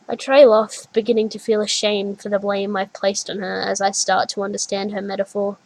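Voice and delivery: usual speaking voice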